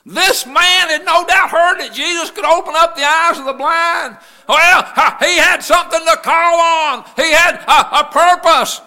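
high, shouted sermon cry; full-force preaching. Real, not acted. Preaching about blind men and Jesus, full-force shout. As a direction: preach it loud and high to the whole church.

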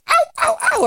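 high little yipping whimper; squeaky imitated dog whimpers; puppy yips. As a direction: yip like a tiny hopping puppy.

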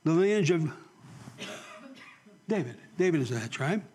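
ordinary delivery

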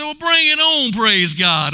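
high, loud fighting challenge; cocky challenge. Retold or imitated. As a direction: call out a rival with gusto. Bravado retold from a fight.

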